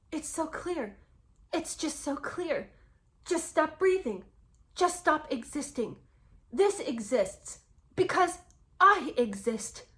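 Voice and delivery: mock-tragic, each phrase drawn out and set apart; soap opera dialogue. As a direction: ridicule it with overwrought melodrama. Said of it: "what in the world is this soap opera dialogue".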